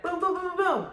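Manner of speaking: rapid-fire booms. Retold or imitated. Imitating rapid-fire easy births.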